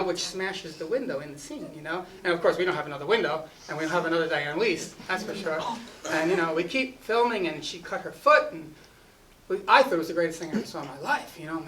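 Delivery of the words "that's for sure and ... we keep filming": usual speaking voice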